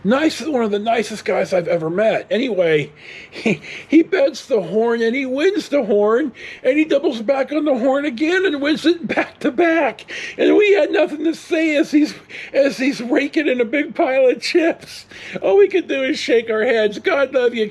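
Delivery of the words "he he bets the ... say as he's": laughing as you tell it, higher; real laughter telling a lucky win; laughing excitedly. Real, not acted. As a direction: break into a laugh-shout at the win. Retelling an amazing craps win.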